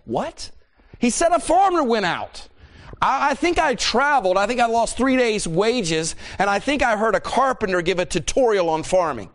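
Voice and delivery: high-pitched, exasperated disbelief; incredulous outburst. Acted. Wife scoffing at husband's farming story, incredulous.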